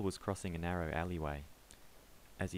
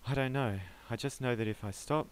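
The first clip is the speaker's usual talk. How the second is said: panicked pleading; 6 semitones higher, 5 dB louder than usual. Acted.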